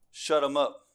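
ordinary talk

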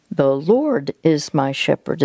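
"Lord" lifted and stretched; stress on LORD. Shown as a demonstration. Counselor shows how to repeat the psalm, stress on LORD. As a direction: punch the word "Lord". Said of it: "you'll emphasize a different word each time".

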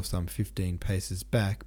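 ordinary delivery

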